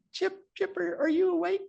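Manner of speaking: high, gentle imitated surgeon's question; Dick Kirby's voice. Surgeon Dick asking his awake patient mid-scope, high imitated voice. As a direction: ask it in the colleague's high voice. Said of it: "He kinda talks like this".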